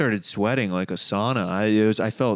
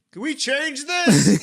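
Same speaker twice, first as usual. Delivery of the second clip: whiny bar patron; 4 dB louder, slower than usual. Retold or imitated. Annoyed bar patron in back.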